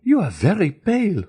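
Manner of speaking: Eugénie to Valentine, a sudden cry; cried out. A narrator's reading. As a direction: exclaim it in alarm. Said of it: "cried Eugénie".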